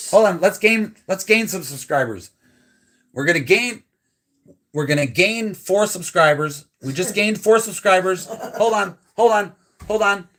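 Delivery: high, loud running commentary; playful mock excitement. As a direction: announce gains in pretend frenzy. Joking about gaining subscribers live.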